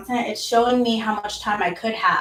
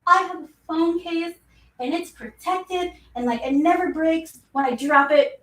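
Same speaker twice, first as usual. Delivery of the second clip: carefree past self; 7 semitones higher than usual. Retold or imitated. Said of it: "and I'd be like".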